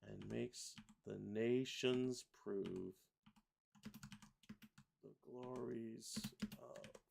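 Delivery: slow, halting lyric; drawn-out carol dictation; syllable-by-syllable reading. Shown as a demonstration. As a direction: let each syllable wait for its note. Reads lyrics while pasting syllables.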